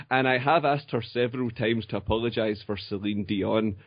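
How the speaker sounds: usual speaking voice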